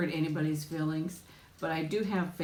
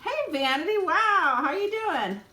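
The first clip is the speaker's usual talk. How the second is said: much higher and louder, delighted hello; light up at seeing her name; excited welcome to a returning viewer; thrilled greeting; 12 semitones higher, 5 dB louder, slower than usual. Real, not acted. Greeting a viewer who joined.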